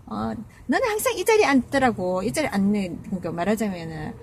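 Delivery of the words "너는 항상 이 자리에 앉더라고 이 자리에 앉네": higher, louder mock scolding; retold angry outburst. Grandmother scolding the speaker over a fixed seat, mildly angry. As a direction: snap at her like the angry grandmother. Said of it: "화를 빵 내면서".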